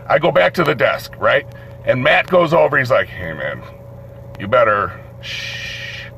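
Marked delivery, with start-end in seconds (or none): 3.1-6.1 very low, soft caution ending in a shush; low murmured heads-up; Matt's hushed warning. Retold or imitated. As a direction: warn him discreetly, keeping it down. Matt quietly warning the noisy inmate.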